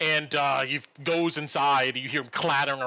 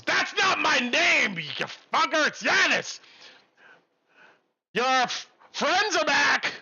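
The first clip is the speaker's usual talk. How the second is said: high, loud indignant shout; angry yell; 12 semitones higher, 4 dB louder, slower than usual. Acted. Cook shouting back from the kitchen, high indignant yell. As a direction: bellow back, offended.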